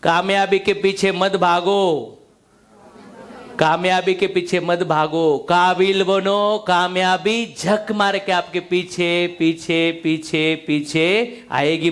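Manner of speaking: slow, weighty Hindi film line; slow filmi dialogue. Retold or imitated. Quoting his mentor's line to the hall, slow and dramatic. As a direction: drag each phrase out like a movie hero's punchline. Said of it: "त्यांनी एक dialogue मारला".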